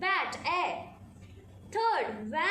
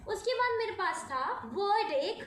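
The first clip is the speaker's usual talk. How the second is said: ordinary talk, nothing marked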